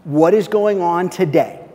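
ordinary talk, nothing marked

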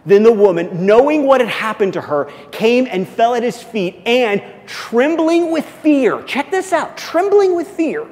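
loud, high, driving sermon delivery; climbing fervent preacher cry; preaching at full force. Acted. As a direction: preach it out to the back row.

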